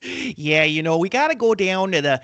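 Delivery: put-on Chicago accent, hammed up. Shown as a demonstration. Host showing off for his co-host on request, Chicago accent. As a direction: lay on a thick Chicago accent. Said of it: "a Chicago accent, please?".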